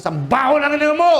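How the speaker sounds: high, taunting insult; mocking possessed man. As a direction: jeer back at the pastor. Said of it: "Sabi nung, ano, tao". (high-pitched na pang-aasar ng sinasapian sa pastor)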